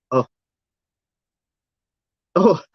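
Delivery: ordinary delivery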